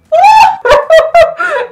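very high squealing laugh. Real, not acted. Vlogger laughing at falling bride, squealing laugh.

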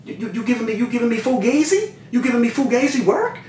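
high, incredulous stammering question; retold accusation. Confronting the supplier over fake work, retold incredulous accusation.

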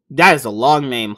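ordinary talk

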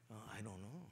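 soft, low, doubtful reply; unsure mumble. Acted. Churchgoer asked about going to heaven, hushed and unsure. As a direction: act out someone quietly unsure.

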